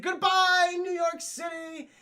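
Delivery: high, loud, slow hammed-up farewell; gloating villain. Mysterio gloating over the city, high slow hammed-up farewell. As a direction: draw it out as a villain's goodbye.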